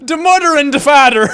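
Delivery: loud, high mimicry of broad Irish; Irish accent. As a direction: gleefully parrot the Irish accent. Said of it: "this is one of those accents".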